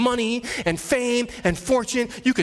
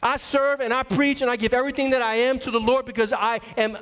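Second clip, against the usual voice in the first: ordinary talk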